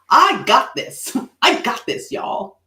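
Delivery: high, slow, cocky swagger; confident. Shown as a demonstration. Showing a confident guy on stage, cocky and slow. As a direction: play it cocky and sure. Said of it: "looks pretty like he's like".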